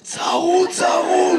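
high, strained hoarse croak. Retold or imitated. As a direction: imitate a man who has lost his voice. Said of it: "למה אתה נשמע ככה". (קול צרוד ומאומץ)

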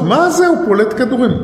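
high-pitched imitation of outcry; mild mock yell. People protesting that the gun fires by itself, mild mock yell. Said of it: "אבל התחילו לצעוק".

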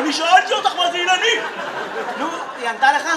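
high-pitched imitation of a child; ילד שואל. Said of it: "הילד". (ילד דורש תשובה בקול גבוה)

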